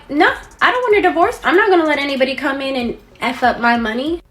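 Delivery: high, loud mock protest; indignant refusal. Husband refusing his wife a divorce, acted indignation. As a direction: push back high and loud, easing slightly.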